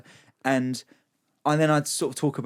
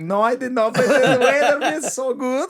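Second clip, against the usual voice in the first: higher, slow, laughing fan voice; mock the fan who only loved the video; gushing fan; 11 semitones higher, 5 dB louder than usual. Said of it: "It's like".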